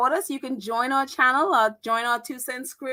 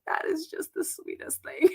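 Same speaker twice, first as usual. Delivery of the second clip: doting; 5 semitones higher, 6 dB quieter than usual. Retold or imitated. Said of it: "I'm like".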